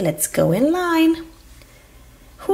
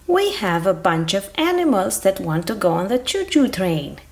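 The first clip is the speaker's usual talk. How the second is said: usual speaking voice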